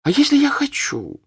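put-on a teenage girl repeating herself to defy her mother; defiant girl. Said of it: "сказала Наташа".

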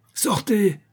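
high, sharply falling command; angry cry. A narrator's reading. Countess throws her niece out, stamping, with an angry cry. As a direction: order her out in a fury. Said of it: "cria la comtesse en frappant du pied".

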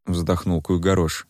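ordinary delivery, nothing marked

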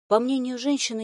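ordinary delivery

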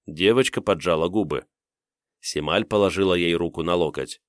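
ordinary delivery

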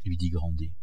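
ordinary delivery, nothing marked

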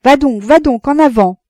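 loud, high shout to the driver. A narrator's reading. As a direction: urge the coachman on at full voice. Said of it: "Il cria au postillon". (crié fort au postillon)

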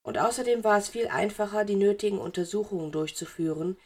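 plain reading voice

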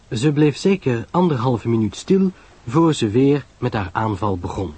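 ordinary delivery, nothing marked

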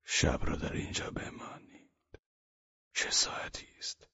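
too tired to raise his voice; faint whisper. Acted. The sick man whispers to the guest to stay the night.

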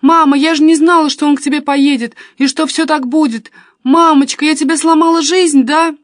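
high, loud tearful pleading; crying on the phone. A narrator's reading. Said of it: "рыдала она в трубку".

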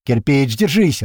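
loud desperate yell to a wounded friend. A narrator's reading. Narrator yells to wounded Kirpich to hold on, loud. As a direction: shout it across the battlefield. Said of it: "ору я".

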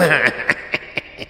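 unvoiced creepy snicker; a gloating evil chuckle; soft sinister laughter; breathy demon laugh. A narrator's reading.

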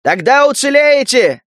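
high, shouted promise; roaring shout. Acted. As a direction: yell it over the battle. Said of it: "заорал Лука".

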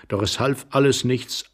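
ordinary delivery, nothing marked

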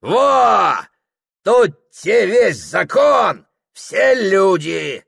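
high, loud, slow declaration; boastful shout. Acted. Host slapping his wallet before guests, shouting boastfully. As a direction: hammer it out loudly and slowly.

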